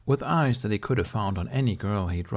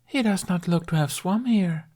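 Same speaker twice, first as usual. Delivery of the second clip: high, amused mermaid observation; airy sea-maiden remark, lifted high; 10 semitones higher than usual. A narrator's reading. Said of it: "a mermaid ... observed".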